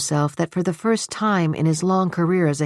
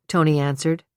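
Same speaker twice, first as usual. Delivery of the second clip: ordinary delivery, nothing marked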